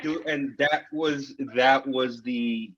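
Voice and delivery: slow and emotional. Real, not acted. Emotional recollection slowing down.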